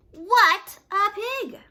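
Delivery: loud, high, slow outrage; indignant. Acted. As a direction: spit it out in disgust. Wolf's indignant outburst.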